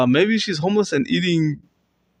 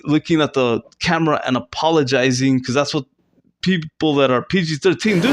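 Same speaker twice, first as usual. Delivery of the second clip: ordinary talk, nothing marked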